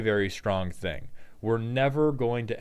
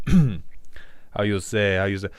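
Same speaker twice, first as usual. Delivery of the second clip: put-on French accent as Gambit. Streamer doing a viewer-requested Gambit intro in a light mock French accent. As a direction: purr the name like a hammy Cajun charmer. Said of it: "Can you use your accent and say".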